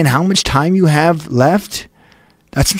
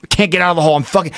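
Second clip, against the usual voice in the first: ordinary talk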